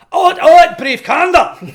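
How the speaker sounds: hammed-up Shakespearean shout; dramatic outburst. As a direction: burst out like a tragic actor. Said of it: "I stood up on the desk and I was like".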